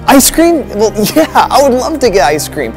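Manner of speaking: high, loud eager acceptance; delighted. Acted. Brother accepts villain's ice cream invitation, excited delight. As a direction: jump happily at the offer.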